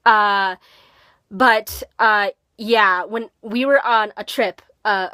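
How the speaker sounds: ordinary delivery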